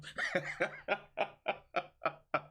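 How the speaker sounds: long burst of laughter; laughing. Real, not acted. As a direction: laugh it out in a rapid run. Speaker's own hearty laugh.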